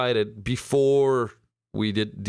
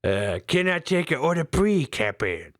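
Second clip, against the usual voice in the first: mock Chinese accent; 4 semitones higher than usual. Showing his co-host how to do the accent, as a mock Chinese waiter. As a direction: take an order in a put-on Chinese accent. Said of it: "when you do the accent".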